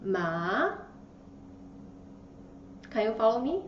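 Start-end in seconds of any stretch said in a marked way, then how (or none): 0.0-0.8 "Ma" sweeping upward in pitch; second tone. Shown as a demonstration. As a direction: say "Ma" in Mandarin second tone, rising to the end. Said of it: "It goes up".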